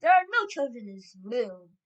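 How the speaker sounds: loud, scornful shout; Grand High Witch. A narrator's reading. Leader dismissing the smell, shouting scornfully. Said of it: "shouted the Grand High Witch".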